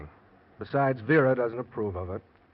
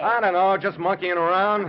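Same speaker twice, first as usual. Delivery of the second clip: called reply; 10 semitones higher, 8 dB louder than usual. Acted. Fred answers across beach.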